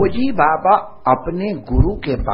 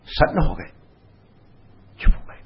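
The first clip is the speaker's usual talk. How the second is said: ordinary talk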